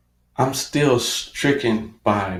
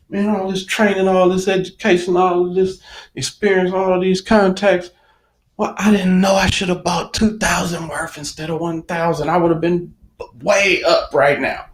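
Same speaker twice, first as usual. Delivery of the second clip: higher, exasperated listing of complaints; exasperated rant; 6 semitones higher than usual. Retold or imitated. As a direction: vent at yourself in disbelief. Frustrated rant to himself.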